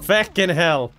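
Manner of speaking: sudden loud curse; pained curse. Real, not acted. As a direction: swear it out in pain. Hit by trap, swearing.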